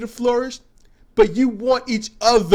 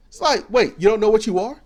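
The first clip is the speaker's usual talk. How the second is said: usual speaking voice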